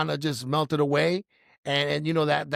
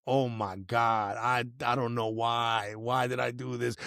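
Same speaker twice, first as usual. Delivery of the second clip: low, slow retold lament; mock despair, dragged out low and soft; desperate; 6 semitones lower, 4 dB quieter, slower than usual. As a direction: plead helplessly under your breath, replaying it. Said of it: "like, desperate".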